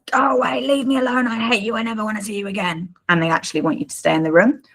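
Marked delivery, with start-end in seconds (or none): 0.1-2.9 high, pushed-away child voice, mock-upset. Child telling parent to go away, high mock-upset child voice. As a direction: voice a kid yelling at the parent to leave, pitched high. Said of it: "So they might say".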